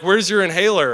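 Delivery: high, loud demanding question; coaches demanding. Retold or imitated. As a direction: bark it like a worried coach. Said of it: "they're like".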